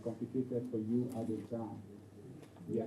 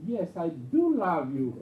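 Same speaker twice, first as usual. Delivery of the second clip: slow, raised, emphatic "do love"; insistent Peter; 5 semitones higher, 8 dB louder, slower than usual. Acted. Peter assuring his Master, slow emphatic insistence. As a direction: stretch "do love", loud and high. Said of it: "And Peter will be upset".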